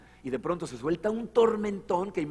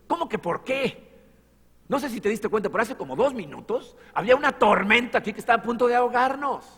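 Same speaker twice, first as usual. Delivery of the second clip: incredulous protest; 7 semitones higher, 6 dB louder than usual. Retold or imitated. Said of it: "Se imaginan lo que pensaron los discípulos".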